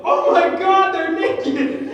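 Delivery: loud, high classroom outcry; yelling. Retold or imitated. One kid yells to the class during Macbeth, shouting. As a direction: yell it like a scandalised kid, laughing. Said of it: "one kid yells".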